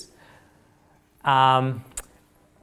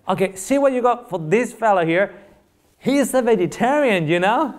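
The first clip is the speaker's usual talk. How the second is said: higher, amused voice of a teasing friend; joking friend; 7 semitones higher than usual. Retold or imitated. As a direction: play the prankster friend tipping off the waitress. Friend jokes to waitress in restaurant.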